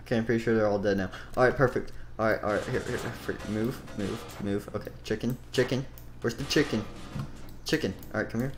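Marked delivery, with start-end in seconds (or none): none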